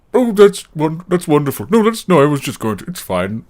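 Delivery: high, flustered backpedaling. Acted. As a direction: cover up an interrupted yell nervously. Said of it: "and he's like".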